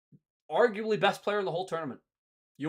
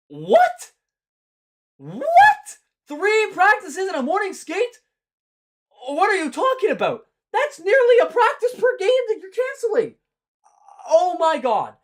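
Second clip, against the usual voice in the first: squeaky, much higher mock outrage; shrill-sounding mock incredulity, far higher than normal; 9 dB louder than usual. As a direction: ham up the disbelief in a high, louder shriek. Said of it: "Sorry I keep doing this mocking voice".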